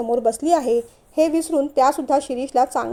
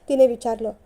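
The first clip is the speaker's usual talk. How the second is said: usual speaking voice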